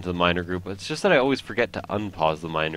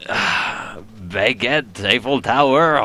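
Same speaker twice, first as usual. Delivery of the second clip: hammed-up French accent, building to a louder "Eiffel Tower"; mock French cliché; 3 semitones higher, 6 dB louder, much slower than usual. Gamer mocking his own French heritage, hammed-up French accent. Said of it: "as we say in France".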